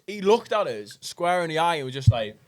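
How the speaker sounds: ordinary delivery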